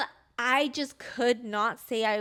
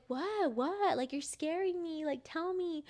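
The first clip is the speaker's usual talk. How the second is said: high, softer imitation of a worried mom; anxious pleading; 5 semitones higher, 4 dB quieter, slower than usual. Scared mom begging daughter to tell her, imitated anxious pleading. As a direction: plead lightly for an answer in a raised pitch.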